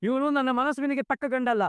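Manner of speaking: high, indignant outburst; a father's outraged verdict on the groom. Acted. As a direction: spit out the rejection in disgust.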